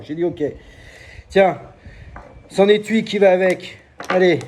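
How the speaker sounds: high, loud fairground sales patter; market hawker pitch. Shown as a demonstration. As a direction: sell it like a market barker.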